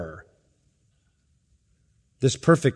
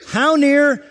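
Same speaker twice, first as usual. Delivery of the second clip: rhetorical question; 10 semitones higher, 4 dB louder than usual. Acted.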